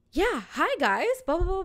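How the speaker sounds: greeting riders, lightly acted; chatty bus driver. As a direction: imitate a driver greeting boarders in a sing-song patter. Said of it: "talking to people as they walk in".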